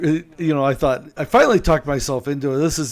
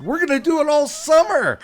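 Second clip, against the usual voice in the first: much higher and louder, beaming; gushing cheer; 6 dB louder than usual. Retold or imitated. Captain announcing the summer plan, gushing. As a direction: toss it off like it's a treat.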